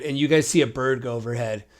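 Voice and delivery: ordinary talk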